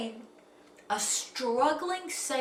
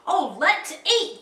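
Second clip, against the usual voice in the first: loud, higher eager cry; exclaiming; 5 semitones higher, 5 dB louder than usual. A narrator's reading. Captain hearing the meal is ready, eager exclamation. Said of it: "Captain Dalbert exclaimed".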